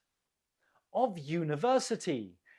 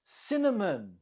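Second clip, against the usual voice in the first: "Cinnamon" stressed on CIN, falling away after; stress on first syllable; 3 semitones higher than usual. Shown as a demonstration. Teacher models the word for learners, CIN stressed in "Cinnamon". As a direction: hit the first syllable of "Cinnamon", reduce the rest. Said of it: "the stress is on the first syllable".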